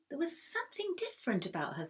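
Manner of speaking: plain reading voice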